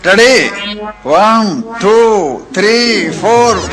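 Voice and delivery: loud, drawn-out count-off; punchy playground countdown. Acted. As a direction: call out each number for the game. Counting off in a children's game.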